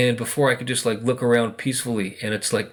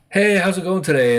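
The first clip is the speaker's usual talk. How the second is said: higher and louder, bright hello to yourself; cheery self-greeting; 7 semitones higher, 5 dB louder than usual. Retold or imitated. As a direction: greet yourself like an old friend. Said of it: "I'll be like".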